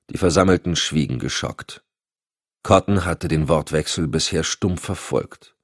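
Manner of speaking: ordinary delivery, nothing marked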